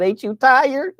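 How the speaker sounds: high, teasing question that breaks into a laugh. Real, not acted. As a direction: needle them, then laugh.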